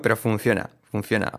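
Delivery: ordinary delivery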